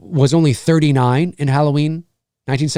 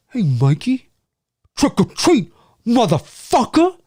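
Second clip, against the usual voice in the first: drawn-out impression, pitched up on the catchphrase; imitating a movie line; 5 semitones higher than usual. Streamer quoting a Halloween film character, impression. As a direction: put on his voice, stretching the last word. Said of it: "That's kinda how he says it".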